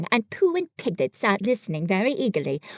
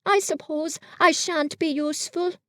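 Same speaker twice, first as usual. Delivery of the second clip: sad; 7 semitones higher than usual. Acted. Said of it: "said Pooh sadly".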